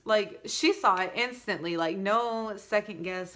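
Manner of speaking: ordinary talk, nothing marked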